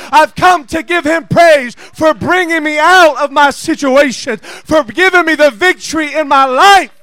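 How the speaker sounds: preaching building to a full-voiced shout; rising revival-style testimony; fired-up preaching. Real, not acted.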